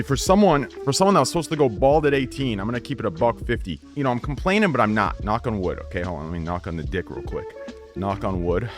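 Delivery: ordinary talk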